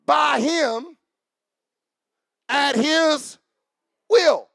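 loud, slow, emphatic preaching; preaching scripture reading. Real, not acted. Preacher reads the next verse to the congregation, loud slow proclamation. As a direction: proclaim the verse in weighty, separated phrases.

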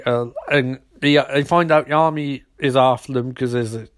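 ordinary delivery

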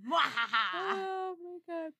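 drawn-out whine; whiny distressed. Real, not acted. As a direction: stretch it out, pained. Dog woken up, distress.

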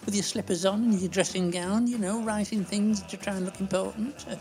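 put-on fussy, gossipy old dear, fluttering up and down; chatty old lady Doris. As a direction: play a prim, scatterbrained pensioner scolding the host. Performs the Doris character throughout.

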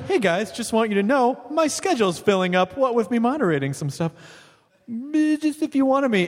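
high, needy fake-casual phone voice; creepy stalker. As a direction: angle for the job, overly eager. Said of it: "like a creepy stalker".

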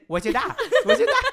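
squeaky high, loud laughing imitation of the Scottish dad; laughing high mock accent of a friend's father. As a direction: shriek it back mockingly as a Scottish dad. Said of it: "Dude, he said".

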